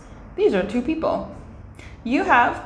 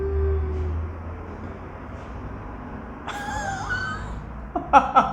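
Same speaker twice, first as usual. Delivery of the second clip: a long high burst of real laughter; 11 semitones higher, 5 dB quieter than usual. Real, not acted. Laughing at a man outside the window. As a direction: laugh helplessly at what you just saw.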